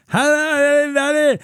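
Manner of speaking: high, loud mocking imitation of a deaf coworker's speech; deaf accent. Comedian mocking the head cashier's overhead page, high and loud. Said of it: "she had deaf accent".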